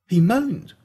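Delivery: he moaned, said aghast; shocked disbelief. A narrator's reading. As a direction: throw it out like you can't believe it. Repeats the line in shocked disbelief.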